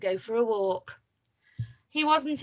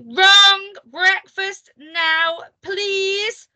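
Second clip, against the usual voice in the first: high, loud, drawn-out command; imperious shout, every word stretched; bossy yell; 9 semitones higher, 9 dB louder, much slower than usual. A narrator's reading. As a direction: yell each word slowly as an order. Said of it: "yelled Miss".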